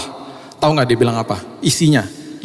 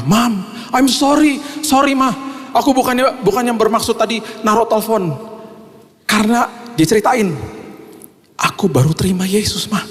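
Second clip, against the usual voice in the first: emotional son; 9 semitones higher than usual. Retold or imitated. Son phoning his mother to say sorry, high and pleading. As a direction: pour out the apology from far up.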